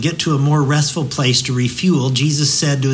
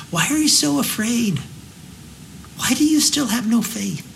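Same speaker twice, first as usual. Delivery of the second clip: high, soft questioning as Jesus; gentle rebuke; 8 semitones higher, 5 dB quieter than usual. Retold or imitated. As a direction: ask it gently, a little disappointed.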